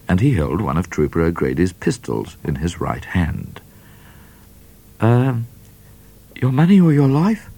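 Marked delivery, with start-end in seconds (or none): 5.0-7.5 higher, hesitant, shy asking; a lightly voiced shy robber; shyly. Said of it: "rather shyly".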